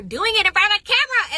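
loud, high, gleeful announcement; excited yell. Real, not acted. Telling fans she's back on camera, excited yell. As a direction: shout the news with pitch way up.

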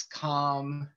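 usual speaking voice

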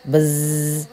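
long, droning insect buzz; imitated monotone buzzing; mosquito buzz. A narrator's reading. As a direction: hum like a mosquito by the ear. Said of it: "came humming in his ear".

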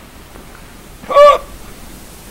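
loud high mock gasp; dramatic gasp.

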